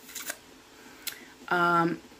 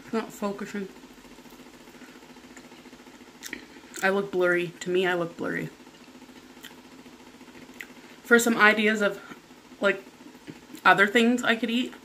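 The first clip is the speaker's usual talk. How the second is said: talking with mouth full; speaking between chewing bites; biting into food while talking. Real, not acted. Seen 0: bites wing at 0.4–1.9 and 8.5–11.5; chews between.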